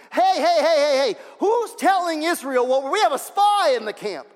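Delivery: loud, high indignant shouting; acted shout of a king who smells betrayal; alarmed king's outburst. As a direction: call out an urgent, outraged alarm, hammed-up.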